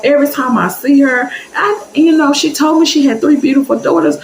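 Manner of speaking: high, syrupy compliments; gushing church lady. Retold or imitated. The church lady flatters the teller and her mom, high and gushing. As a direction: pour on the flattery.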